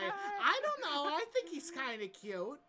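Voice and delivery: put-on high, coy crow girl voice. Crow girl from afar swooning over the orc, high and coy. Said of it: "you faintly hear from across the way".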